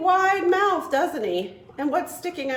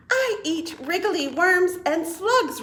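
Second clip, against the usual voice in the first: bird's reply; 7 semitones higher than usual. Acted. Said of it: "replied the bird".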